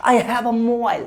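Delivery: "a" as a short "aa" in I have a mobile; correct article sound. Shown as a demonstration. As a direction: model "a" as "uh" before mobile. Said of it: "आ बोलो". ("a" का सही "आ" उच्चारण)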